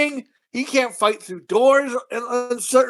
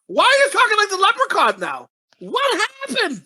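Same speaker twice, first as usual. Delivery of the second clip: baffled; 10 semitones higher than usual. Real, not acted. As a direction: ask in total disbelief. Baffled ranting at the movie.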